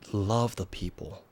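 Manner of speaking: normal talking voice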